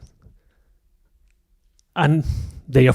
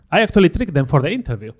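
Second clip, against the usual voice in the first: ordinary talk, nothing marked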